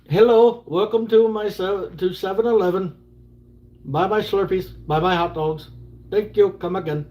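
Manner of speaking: high-pitched put-on Indian accent. Shown as a demonstration. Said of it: "I think I can do an Indian accent".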